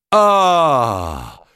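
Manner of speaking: loud, high, open-armed welcome; cried out. A narrator's reading. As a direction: greet him with a booming cry. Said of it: "s'écria-t-il en ouvrant les bras".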